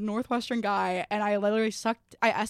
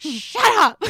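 higher, louder snap of the words; loud mock shut up; 8 semitones higher, 13 dB louder than usual. Comic's bit, barked at a sneezer loudly. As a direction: bark it at the sneezer. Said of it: "he's saying "shut up" is so funny".

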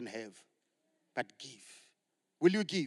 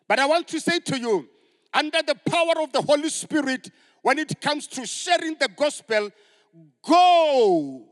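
loud, high preacher's cry; full-force preaching; 6 semitones higher, 14 dB louder than usual. Acted. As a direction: build to a rousing shout.

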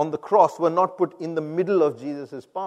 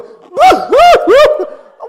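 loud, high imitated bawling; exaggerated burst of wailing sobs; mock sobbing; 19 dB louder than usual. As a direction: break into hammed-up loud crying. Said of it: "And until I go".

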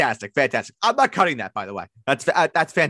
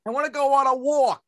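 much higher and louder, with a New York walk; a hammed-up, loud New York protest; a loud, high, mock New York voice; New York rant; 12 semitones higher, 3 dB louder than usual. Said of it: "repeats the New York line".

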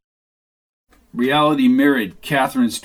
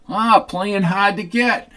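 slow, higher, leering tease; lightly voiced smug Sid; flirty Sid; 8 semitones higher, slower than usual. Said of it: "said Sid as he looked over Roz".

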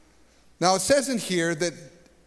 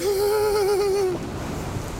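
long, very high-pitched mock scream; overwhelmed scream. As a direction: let out a sustained cry of overload. Acts out being overwhelmed.

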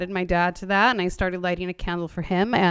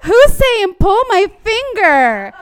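much higher and louder, laughing, slow on pull my finger; laughing medium; 11 semitones higher, 11 dB louder, slower than usual. Retold or imitated. Medium laughing as he relays the dad's joke. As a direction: squeal it out in amused disbelief.

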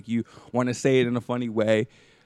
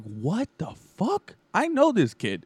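high-pitched, drawn-out mock astonishment; stunned disbelief; 8 semitones higher, slower than usual. Host recalling seeing the guest online, mock stunned. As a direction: retell it with rising, amazed recognition.

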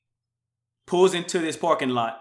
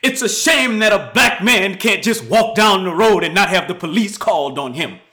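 higher, louder public protest; loud complaint; 5 semitones higher, 7 dB louder than usual. Retold or imitated. Man protesting the police call, loud. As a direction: proclaim it to the whole store. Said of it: "this dude is loud too".

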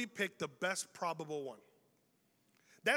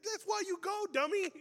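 mock retort; 11 semitones higher than usual. Preacher mocks sick-friend excuse.

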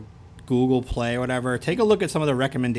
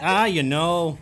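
higher Fargo accent; 5 semitones higher, 4 dB louder, slower than usual. Shown as a demonstration. Showing co-host the Fargo talk, Fargo accent. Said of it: "That's how they talk in Fargo".

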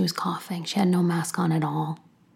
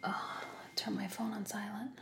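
hushed unvoiced aside to herself; whispered aside; 3 semitones higher, 15 dB quieter than usual. Real, not acted. Muttering to herself mid-recording, a whispered aside. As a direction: whisper a quick reminder off to the side.

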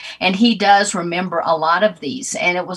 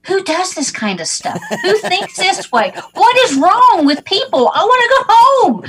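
high, loud, laughing disbelief; exasperated wail; 11 semitones higher, 7 dB louder than usual. Retold or imitated. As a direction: throw up your hands and wail it. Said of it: "I'm like".

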